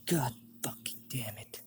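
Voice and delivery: hushed, seething curse; pissed. Acted. Zod curses at the leader's trap, quietly pissed. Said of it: "Zod is pissed".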